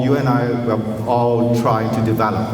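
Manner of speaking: ordinary talk, nothing marked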